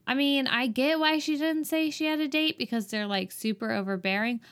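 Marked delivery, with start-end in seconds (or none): none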